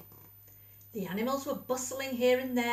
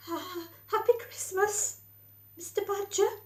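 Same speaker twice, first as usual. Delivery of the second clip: much higher, small shy voice; timid; 9 semitones higher than usual. A narrator's reading. Mole greeting grumpy Badger at his door, timid and high. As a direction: greet him nervously, hoping not to annoy. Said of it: "he said timidly".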